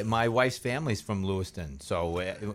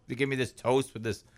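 ordinary talk

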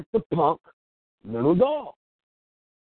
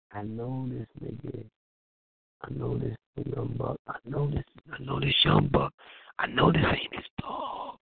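muttered to himself; 8 semitones lower, 5 dB quieter than usual. Retold or imitated.

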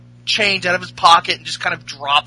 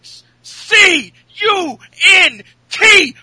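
higher and louder, spelling it out slowly; mock insult shout; 10 semitones higher, 8 dB louder, slower than usual. Host imitating Cass insulting Enzo, slow spelled shout. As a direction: build to a screamed final word. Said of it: "Cass says".

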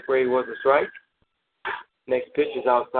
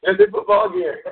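laughing through the words; cracking up mid-sentence. Real, not acted. Cracks up at own joke.

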